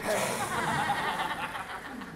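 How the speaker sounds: soft scornful stage laugh; mocking laugh. Queen mocks king.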